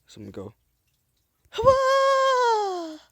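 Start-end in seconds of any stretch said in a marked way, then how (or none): 1.5-3.0 high shouted war cry; battle cry. Retold or imitated. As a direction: yell it like a charging warrior. Game character battle cry.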